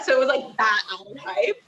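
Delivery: ordinary delivery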